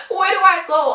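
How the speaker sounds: high, loud playful outcry; mock wail. Wanted employee torn between teams, mock wailing. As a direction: cry it out high and loud, amused. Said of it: "So I'm like".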